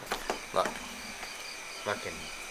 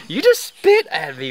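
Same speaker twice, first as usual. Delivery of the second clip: much higher, loud startled cry; startled yelp; 7 dB louder than usual. Real, not acted. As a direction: yelp in surprise. Spat on while eating.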